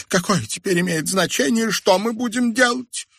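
very high, plaintive wail; high-pitched hopeless complaint; despairing. A narrator's reading. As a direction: whine that nothing matters. Mother wails that all is lost.

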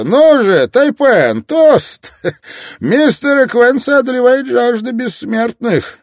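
very high, loud theatrical cry; ringing, hammed-up shout, far above normal pitch; exclaimed toast. A narrator's reading. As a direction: proclaim it over a laughing crowd. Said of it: "Затем он воскликнул среди всеобщего хохота".

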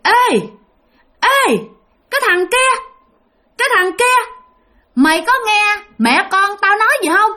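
high-pitched screechy ghost calling out; shrill, creepy ghost woman. Acted. Ghost woman hailing the fisherman from the tree, shrill and creepy. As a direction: call down in a piercing, eerie woman's voice. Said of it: "một cái giọng bà the thé rất là ghê".

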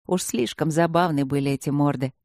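ordinary delivery

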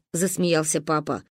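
ordinary delivery

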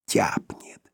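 gleeful whisper. A narrator's reading. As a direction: breathe it out with relish. Dog relishes the bite. (восторженный шёпот)

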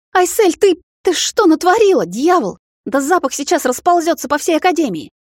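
high, loud, agitated exclamation; panicked scolding. A narrator's reading. Vampire scolds the girl as the smell spreads, high panicked outburst. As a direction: stammer out an alarmed reproach, pitch jumping up.

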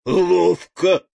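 loud, high admiring exclamation; delighted. A narrator's reading. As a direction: cry it out in delight. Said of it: "восхитился лев".

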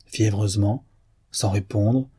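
plain reading voice

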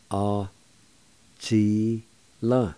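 "a, chi, la." as separate held syllables. Shown as a demonstration. Teacher showing learners the first part "a, chi, la.", syllable by syllable. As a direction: pause after each syllable of "a, chi, la.". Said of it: "First part is pronounced like this".

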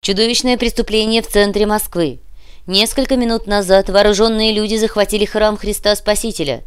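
radio news bulletin. Acted. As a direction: announce breaking news with force.

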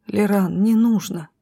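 ordinary delivery

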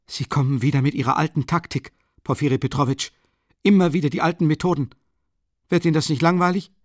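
mocking disdain, rising fast; contemptuous. A narrator's reading. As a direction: sneer at his old tricks. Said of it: "blickte Raskolnikow Porfiri verächtlich an".

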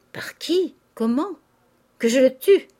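loud, high cry from an enraged father; outraged cry. A narrator's reading. Father demanding whom to kill, crying out. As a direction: cry out the questions, rising to a loud threat. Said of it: "Criait le père Goriot".

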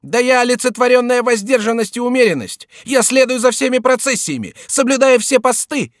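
very high-pitched indignant self-defence; offended, high-flown insistence; outraged protest. A narrator's reading. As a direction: protest loudly, far up in pitch. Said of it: "вскричал Шико".

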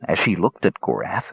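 ordinary delivery, nothing marked

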